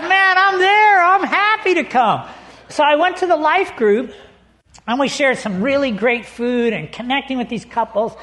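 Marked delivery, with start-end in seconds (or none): 0.0-2.3 eager acceptance. Retold or imitated. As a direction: overdo the enthusiasm, stretching it out. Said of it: "I said".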